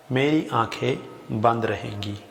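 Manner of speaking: normal talking voice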